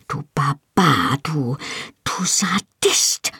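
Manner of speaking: hissed through the teeth, beside herself; furious hiss. A narrator's reading. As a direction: spit the insults in a slow, breathy hiss. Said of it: "zischte ich außer mir". (wütend gezischt, außer sich)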